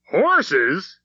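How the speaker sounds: high, loud incredulous shout. A narrator's reading. Father shouting back at his wife, high and loud. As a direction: shout the word in disbelief. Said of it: "he shouted".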